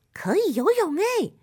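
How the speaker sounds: put-on high-pitched little frog girl; light up with a discovery; high, pleased exclamation; delighted realization. Frog girl realizes she can swim.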